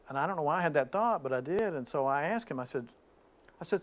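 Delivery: ordinary delivery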